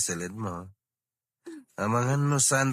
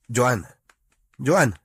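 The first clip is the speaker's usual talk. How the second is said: louder and louder, call her name; 8 dB louder than usual. Acted. Husband in bed calls Joan loudly to wake her.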